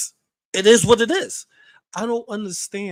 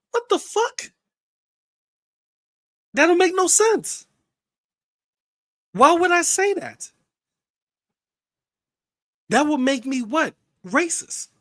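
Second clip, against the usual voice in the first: incredulous; 11 semitones higher, 3 dB louder than usual. Real, not acted. Incredulous at the idea of being racist.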